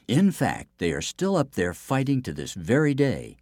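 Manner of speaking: ordinary delivery, nothing marked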